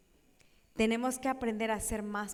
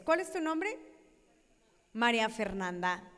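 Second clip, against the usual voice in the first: usual speaking voice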